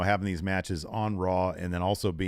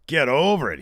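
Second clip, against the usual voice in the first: louder, higher Get over it; mock angry; 5 semitones higher, 7 dB louder than usual. Host retells Seth snapping at Drew, mock angry. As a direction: punch out "Get over it" with irritation. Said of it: "He's getting pissed now".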